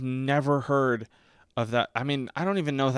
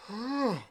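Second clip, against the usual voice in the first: mock gasp; 14 semitones higher than usual. Imagined character noticing something.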